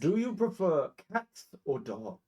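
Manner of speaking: high, slow, weighty question; grand blink dog. Acted. Blink dog Qantas questioning the guest, grandiose. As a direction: pose it like a solemn, pompous interrogation. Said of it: "the first one who says".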